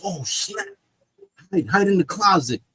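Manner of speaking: hurried warning, lightly hammed-up; mock panic. Wife hiding her lover as husband returns, mock panic.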